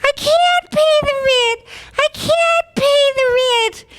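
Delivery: very high, slow helpless wail; damsel in distress. Acted. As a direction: squeak it out like a helpless heroine. Victim in church melodrama.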